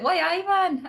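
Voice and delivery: put-on thick northern English accent. Imitating drunk newsagent boss, broad northern English accent. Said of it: "he had such a thick accent".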